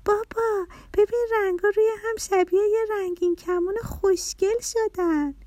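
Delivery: put-on very high, eager little-girl voice; delighted child. A narrator's reading. Little girl showing father the rainbow layers, high and thrilled. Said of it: "با ذوق".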